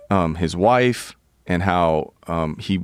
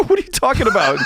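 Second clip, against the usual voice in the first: high, loud-ish incredulous question; mock outraged squawk; acted incredulity; 14 semitones higher, 4 dB louder, faster than usual. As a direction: protest in disbelief, half laughing. Retold disbelief at friend.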